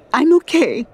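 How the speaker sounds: high, tearful burst; blurted through tears. Acted. Crying woman on the subway, blurted tearful reassurance. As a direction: blurt it out, holding back tears. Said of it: "she blurts".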